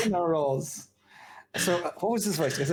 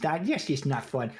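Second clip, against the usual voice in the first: ordinary talk, nothing marked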